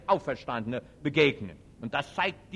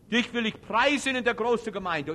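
higher, louder solemn reading; proclaiming psalm; 6 semitones higher, 5 dB louder than usual. Acted. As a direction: lift the verse up. (feierlich verkündet)

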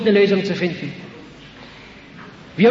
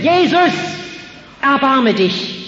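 desperate cry; 7 semitones higher, slower than usual. Retold or imitated. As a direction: cry out for mercy with all your strength. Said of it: "aus Leibeskräften zu brüllen".

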